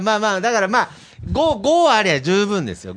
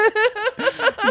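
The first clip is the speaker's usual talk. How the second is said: high laugh burst; 13 semitones higher, 5 dB quieter than usual. Real, not acted.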